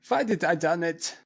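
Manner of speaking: wailing lament. A narrator's reading. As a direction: lament slowly. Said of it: "he wailed".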